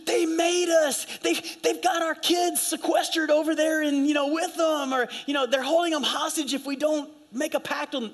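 high, urgent sob story; mock desperate plea. Envoys tricking the enemy with a hostage story, mock desperate. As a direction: plead it high, putting on alarm. Imagined Gibeonite envoys pleading a lie.

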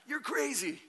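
high-pitched, incredulous retort; mock disbelief. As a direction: scoff playfully in a high voice. Retells his disbelieving reply.

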